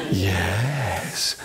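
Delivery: very low drawn-out yes; Brahmin. Shown as a demonstration. Storyteller has children copy the Brahmin's low yes. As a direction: answer low and stretched. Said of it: "Can you do that with me?".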